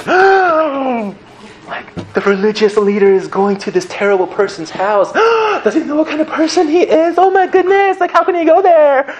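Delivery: high, gasping, scandalized crowd voice; shocked gasping crowd. Retold or imitated. Voicing the scandalized crowd as Jesus goes to Zacchaeus: high, gasping shock.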